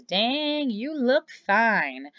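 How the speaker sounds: high, slow, impressed "dang"; admiring inner thought. Shown as a demonstration. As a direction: let the admiration slip out slowly. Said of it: "My inner monologue is".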